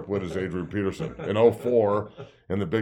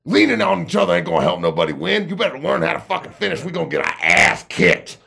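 higher, louder coach bark; coach yelling; 9 semitones higher, 9 dB louder than usual. Retold or imitated. Coach yelling at a player on the field, loud bark. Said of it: "Coach Wilson walks over and goes".

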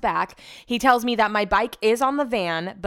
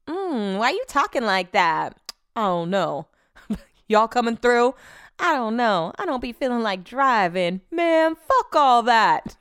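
lightly voiced phone-call skit characters. Retelling a [name] album skit to her viewers, lightly acted. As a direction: act out the skit's back-and-forth. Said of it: "You know that [name] skit".

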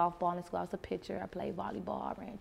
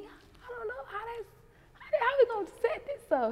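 mock panicked squeak. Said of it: "I'm like".

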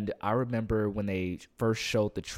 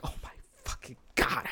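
whispered in disbelief, barely voiced; awed whisper. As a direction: breathe it out like you're overwhelmed. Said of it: "you're just like".

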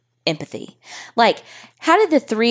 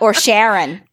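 mock Boston accent; 2 semitones higher than usual. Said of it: "Okay, well".

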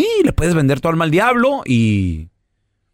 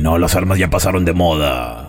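raspy devil; 12 semitones lower than usual. Acted. Devil appears to whoever summoned him, deep raspy voice. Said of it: "Qué voz tan rasposa".

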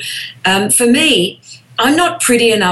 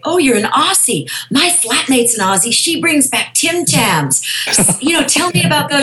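higher-pitched, gushing small talk; mock enthusiastic chit-chat, pitched up; casting people's chatter; 6 semitones higher than usual. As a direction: imitate an over-eager casting agent making conversation. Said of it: "they'll look at your resume and they'll say".

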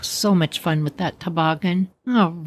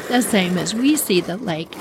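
ordinary delivery, nothing marked

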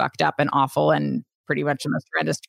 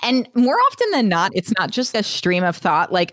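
ordinary talk

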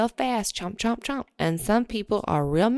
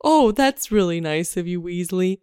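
worm puppet voice. Acted. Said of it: "it's a hand puppet".

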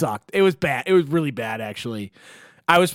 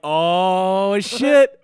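hyped reaction; 3 semitones higher, 4 dB louder than usual. Retold or imitated. Big brother hearing about a slow dance, hyped and teasing. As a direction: stretch it out, then pop upward.

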